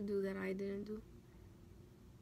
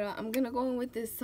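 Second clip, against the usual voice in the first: ordinary talk, nothing marked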